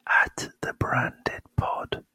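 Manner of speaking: breathy whisper, slow. Shown as a demonstration. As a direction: whisper it close to the mic. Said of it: "can you change your voice a bit".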